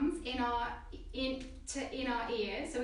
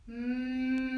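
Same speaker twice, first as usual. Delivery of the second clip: steady sung hum with lips closed; long even hum like a buzzing bee; humming bee sound. Shown as a demonstration. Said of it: "So we'll make the sound together".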